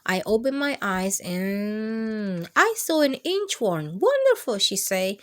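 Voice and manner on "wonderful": much higher, loud gushing teacher; delighted praise. Acted. Teacher to Ralph, gushing higher delight. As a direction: sing it out like a thrilled teacher.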